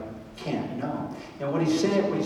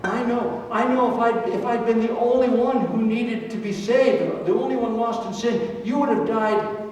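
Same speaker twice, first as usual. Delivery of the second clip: higher, quick, overconfident protest; a churchgoer sure he understands, higher; eager believer; 7 semitones higher, 3 dB louder than usual. Pretend. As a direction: rush in like you already know it all. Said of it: "some of us might be tempted to say".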